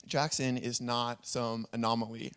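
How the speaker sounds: ordinary talk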